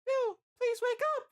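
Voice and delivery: very high, loud falsetto cry; screaming Licia. A narrator's reading. Licia begs the dying hero to wake, screaming. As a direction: cry out slowly in a desperate falsetto. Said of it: "she screams".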